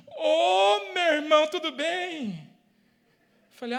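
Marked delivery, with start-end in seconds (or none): 0.1-2.5 high, loud hammed-up cheery hello; mock friendly greeting. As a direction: play the angry driver turning suddenly sweet. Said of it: "ele olhou pra mim e disse assim".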